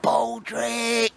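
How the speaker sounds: high, drawn-out mock call to a dog; stretched, high-pitched retold holler. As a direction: imitate calling the dog, pitched right up.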